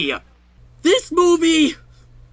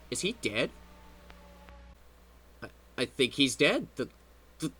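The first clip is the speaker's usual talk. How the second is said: hushed; 9 semitones lower, 14 dB quieter, faster than usual. Acted. Hushed worry.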